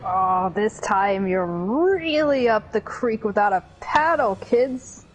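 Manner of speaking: slow, gloating villain taunt; slow theatrical villain menace. Acted. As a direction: ham up the cartoon villain. Reading villain lines aloud.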